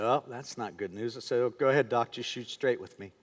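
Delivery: very low, hushed reply; subdued dread. Retold or imitated. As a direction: brace quietly for the doctor's bad news. Patient bracing for bad news, retold.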